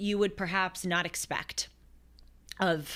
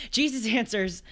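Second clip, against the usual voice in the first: normal talking voice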